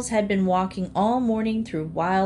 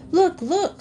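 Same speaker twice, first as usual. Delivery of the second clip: high, eager pointing-out cry; spotting something wonderful ahead; excited calling out; 10 semitones higher, 4 dB louder than usual. A narrator's reading. Freddie calling to friends at first sight of palms, excited. Animals spot Florida.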